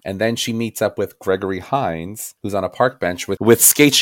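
usual speaking voice

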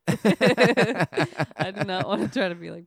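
slow, loud, laughing refusal. Real, not acted. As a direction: laugh through the protest. Laughing protest.

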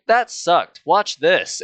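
mock tough-kid bravado; cocky challenge. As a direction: throw down a playful dare. Retold challenge to the bully.

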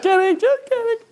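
very high squeaky retraction; mock-panicked falsetto; playful backpedalling. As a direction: take the joke back in a silly high voice. Backpedalling after his own joke.

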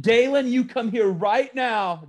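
high, drawn-out scolding call. Pretend. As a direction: summon a misbehaving child, loudly at first. Acted parent calling a child over.

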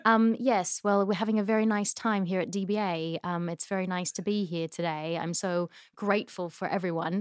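polite, softening English accent. Shown as a demonstration. Thanking everyone at the event, polite English accent. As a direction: speak as a reserved English guest. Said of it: "you give me yours".

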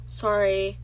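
ordinary delivery

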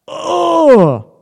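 high, loud "ohh" sliding down; dismayed groan. Retold or imitated. Coach reacting to a manipulative partner's demand, dismayed high groan. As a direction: let out a long, high cry of mock dismay.

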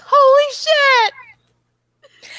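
very high, loud outburst; excited shout. Retold or imitated. Friends mimic their reaction at the event, shouting. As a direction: scream it with disbelief. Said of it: "going like".

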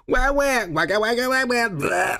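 put-on high babbling baby noises; mock baby babble. Mocking future adults, high baby babble. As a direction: babble like a helpless toddler.

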